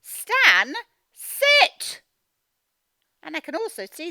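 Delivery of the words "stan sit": Stan, sit! shouted high and loud; exclamation. Shown as a demonstration. Teacher showing how the exclamation mark sounds, shouting. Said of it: "The exclamation mark tells me to say it like".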